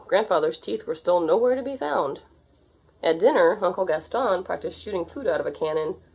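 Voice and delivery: ordinary delivery, nothing marked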